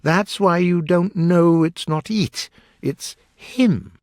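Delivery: lightly voiced Hatter setting someone straight; lecturing Hatter. A narrator's reading.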